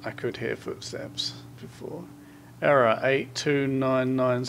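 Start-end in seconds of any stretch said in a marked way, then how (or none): 0.0-2.0 whisper. Real, not acted. Hearing footsteps in horror game.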